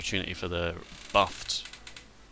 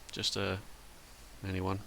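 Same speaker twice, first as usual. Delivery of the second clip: ordinary talk, nothing marked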